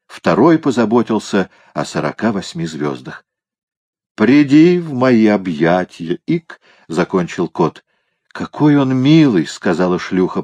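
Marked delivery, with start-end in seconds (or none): 4.1-6.2 slow, drawn-out theatrical summons; effusive drunk cat. A narrator's reading. Пьяный кот зовёт спасителя обняться. 6.3-6.6 short high-pitched hiccup. A narrator's reading. Пьяный кот икает посреди реплики. 8.3-9.5 high, gushing, touched voice; moved to tears. A narrator's reading. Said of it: "прослезившись".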